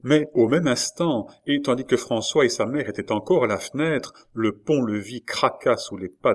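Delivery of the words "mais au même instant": ordinary delivery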